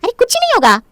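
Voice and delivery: high-pitched, insistent brush-off; dismissive reassurance. Acted. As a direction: override the objection airily. Overriding her repeated objection.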